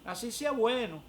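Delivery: high, loud "ah" easing down; imitating amused mother-in-law. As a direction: act out a mother-in-law's knowing reaction. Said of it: "se rió y me dijo".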